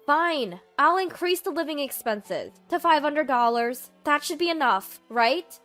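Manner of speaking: loud, high-pitched, testy concession; grudging offer. Acted.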